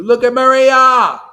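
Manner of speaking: very loud, high shout with a drawn-out name; exclaiming loudly. Real, not acted. Teacher pointing to a student as the example, loud exclaiming. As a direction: hold her up as proof, shouting.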